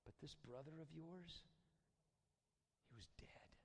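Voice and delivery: very soft, low, near-whispered fatherly reassurance; tender hush. Acted. Dad reassures his angry older son, tender hush. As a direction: speak it almost in a whisper with long pauses.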